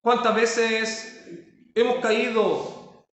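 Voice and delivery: ordinary talk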